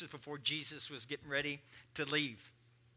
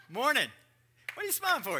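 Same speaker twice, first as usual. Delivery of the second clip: mock puzzled spouse; 8 semitones higher, 6 dB louder than usual.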